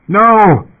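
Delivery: sudden high shout of "No!"; desperate cry. A narrator's reading. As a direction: cry out in panic. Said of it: "he cried".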